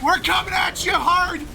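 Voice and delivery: hyped shout. Real, not acted. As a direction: pump it up, shouting. Hyped caster shouting at opponents.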